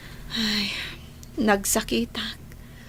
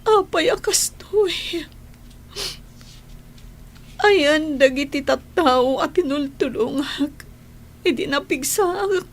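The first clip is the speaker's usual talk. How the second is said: tearful lament; 3 semitones higher than usual. Acted. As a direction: lament through tears, hurt and bewildered. Sick woman laments being abandoned. (agsangsangit nga agun-unnoy)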